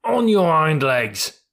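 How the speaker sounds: high, drawn-out challenge; squealed. A narrator's reading. Mule daring the horse to fight, squealing. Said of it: "squealed Billy".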